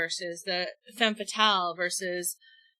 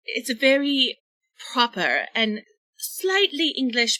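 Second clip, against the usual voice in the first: usual speaking voice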